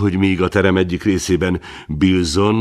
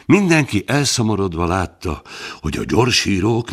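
plain reading voice

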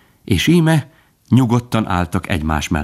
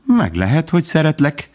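higher, tossed-off careless answer; offhand; 9 semitones higher than usual. A narrator's reading. Faline answering Bambi's love offhandedly. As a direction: say it flippantly, teasing. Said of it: "felelte Faline foghegyről".

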